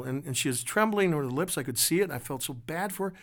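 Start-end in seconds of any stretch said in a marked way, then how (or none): none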